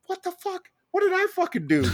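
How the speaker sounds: high, strained panicky squeak; panicked inner voice. Retold or imitated. Juror watched by lawyers, high panicky squeak. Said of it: "I'm like".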